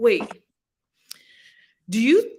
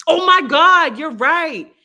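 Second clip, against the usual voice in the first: higher, louder mock delight; mock excited exclaim; 7 semitones higher, 10 dB louder, slower than usual. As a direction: squeal it like a sudden realization. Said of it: "I just said".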